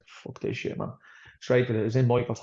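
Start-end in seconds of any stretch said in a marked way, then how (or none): 0.1-0.9 breathy under-the-breath curse, much softer; muttered curse. Retold or imitated. Fighter telling the interviewer how he felt, muttered under his breath. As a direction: mutter it to yourself in frustration. Frustrated with the hospital referral.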